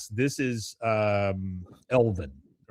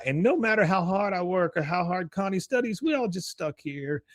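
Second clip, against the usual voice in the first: high-pitched voice of defeat; hammed-up high complaint; Khan; 8 semitones higher than usual. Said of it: "He goes".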